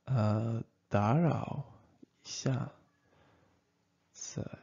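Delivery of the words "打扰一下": ordinary talk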